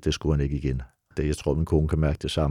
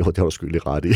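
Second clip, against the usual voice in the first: usual speaking voice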